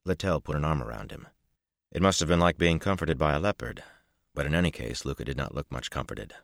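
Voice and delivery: ordinary delivery, nothing marked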